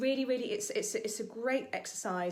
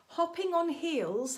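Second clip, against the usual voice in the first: ordinary talk, nothing marked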